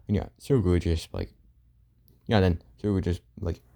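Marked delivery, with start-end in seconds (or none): none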